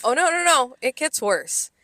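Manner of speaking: excited. Real, not acted. Cuts in, story gets worse.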